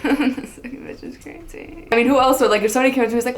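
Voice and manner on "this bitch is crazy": mock whispered thought. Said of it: "he just laughs, like".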